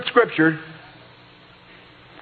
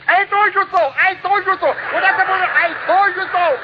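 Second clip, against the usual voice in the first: very high, gleeful I-told-you-so chant; triumphant crowing. Retold or imitated. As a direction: crow it up the aisle in triumph. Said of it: "this is what she's saying".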